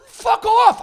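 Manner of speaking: high, loud burst; angry dismissal. Real, not acted. Comic dismissing the friend who insulted him, angry outburst. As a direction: throw it out as an outburst. Dismissing Wilfred mid-rant.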